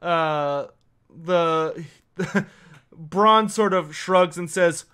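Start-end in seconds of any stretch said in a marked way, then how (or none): none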